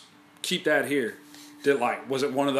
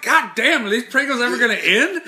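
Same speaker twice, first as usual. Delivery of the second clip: mock amazement; 7 semitones higher, 4 dB louder than usual. Retold shock at endless Pringles.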